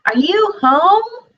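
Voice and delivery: high, slow calling voice. Acted. Caller outside asking if Rabbit is home, calling. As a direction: call through the door.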